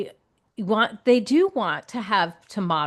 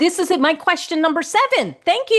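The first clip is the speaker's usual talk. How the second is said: high, louder delighted exclamation; excited thanks; 8 semitones higher, 4 dB louder than usual. Real, not acted. Host whose next question was just answered, excitedly thankful. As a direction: pitch leaps up with glee.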